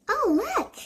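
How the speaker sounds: high, sweet, wide-eyed Dorothy voice; Dorothy delighted at the trees; girlish Dorothy. A narrator's reading. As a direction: gasp it out like a wide-eyed girl. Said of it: "she said".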